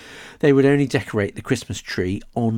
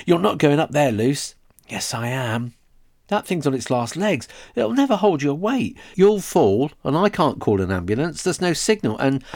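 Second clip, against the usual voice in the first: high, loud frantic pleading; panicked pleading; 6 semitones higher, 3 dB louder than usual. Acted. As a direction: beg her not to climb. Boy panics as sister climbs.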